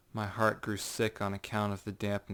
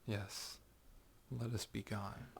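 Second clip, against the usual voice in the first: much softer cold echo; quiet mockery; 14 dB quieter than usual. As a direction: hush it out as a cruel jest. Montresor coldly mocks.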